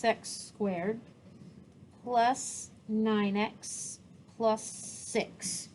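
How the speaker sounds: slow, broken into small pieces; writing aloud. Real, not acted. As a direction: dictate the equation slowly while writing it. Teacher writing out the equation aloud.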